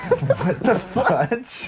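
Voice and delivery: drawn out through real laughter; can barely get the words out for laughing; laughing disbelief. Real, not acted. Sister laughing at brother's fake-crying story, laughing disbelief.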